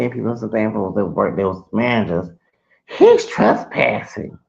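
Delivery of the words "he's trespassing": mimicking manager. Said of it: "So then she said".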